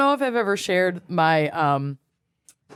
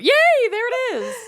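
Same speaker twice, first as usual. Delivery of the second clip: excited. Real, not acted. Excited seeing ornament.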